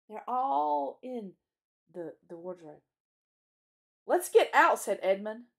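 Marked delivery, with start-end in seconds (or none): 4.0-4.8 loud, high burst; urgent call. A narrator's reading. Edmund urges the others out of the wardrobe, urgent. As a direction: call out to get moving.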